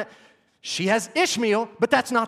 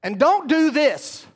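sharp warning; 8 semitones higher, 4 dB louder, slower than usual. Real, not acted.

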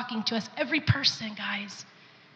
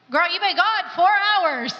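higher, louder, slow exasperated husband; a mock-worried husband calling, higher pitch; husband on the phone; 7 semitones higher, 4 dB louder than usual. Husband calling his wife who's been gone for hours, exasperated, higher and slow. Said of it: "John was calling 'cause he's like".

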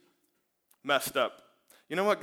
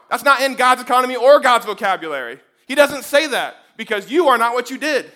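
loud, higher, insistent sermon voice; full-force preaching; 7 semitones higher, 9 dB louder than usual. Acted. Pastor tells the congregation who they are, preaching loud. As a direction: hammer each line home.

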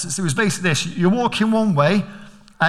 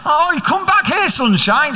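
higher, louder fatherly call; calling son back; 10 semitones higher, 3 dB louder than usual. Retold or imitated. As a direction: call him back, half stern.

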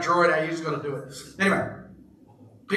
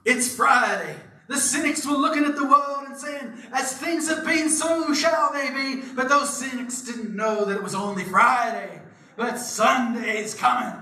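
preacher voice; 7 semitones higher than usual. Retold or imitated. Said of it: "and he goes".

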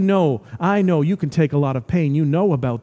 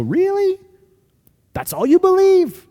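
high, incredulous questioning in a put-on voice; insulted disbelief; 12 semitones higher, 3 dB louder than usual. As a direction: squeal it as if affronted. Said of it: "it's almost like heartbreaking, insulting faith".

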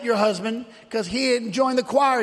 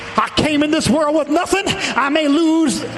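full-force preaching; 4 semitones higher than usual. Real, not acted. Preacher defying the devil and vowing to praise, full-force preaching. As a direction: shout each declaration like a revival preacher.

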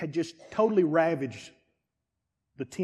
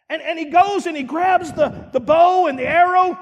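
ordinary delivery

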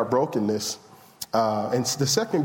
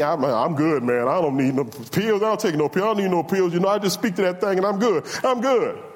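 acted-out stubborn brush-off, rising in pitch; insistent refusal; 4 semitones higher than usual. Preacher mimicking himself refusing pills, mock-insistent. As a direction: wave the pills away, getting higher as you insist.

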